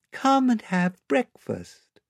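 high, slow, sweet lamb voice; sweet milky voice. Acted. Lamb inviting the children to breakfast, sweet and milky. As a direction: speak sweetly as a lamb, pitched high and unhurried. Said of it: "in its sweet milky voice".

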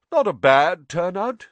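high, loud satisfied boast; pleased, puffed-up host; proud. Acted. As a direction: show off the crowd proudly. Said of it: "said nearly headless Nick proudly".

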